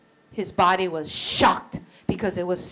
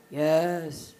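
weak reply; 6 semitones lower, 5 dB quieter than usual. Acted. Dying chief answers weakly.

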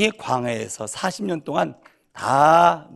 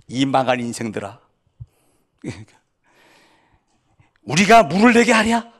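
angry shout; 6 dB louder than usual. Acted. Moses losing his temper at the crowd, shouting. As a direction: let the anger burst out at the end. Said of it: "열받으니까".

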